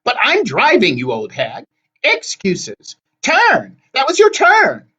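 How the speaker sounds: high, loud screechy squabble; bickering hags. A narrator's reading. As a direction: bicker at the top of your voice. Sisters bicker in the cab.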